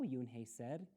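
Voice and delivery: plain reading voice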